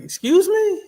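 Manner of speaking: sharp, high indignant question; indignant excuse me. Retold or imitated. Coworker mouthing off, met with a high indignant challenge. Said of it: "and I said, I was like".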